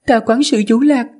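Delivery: high, sweet maiden voice; young woman's voice. Acted. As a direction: thank him as a young woman. Plant maiden thanks steward.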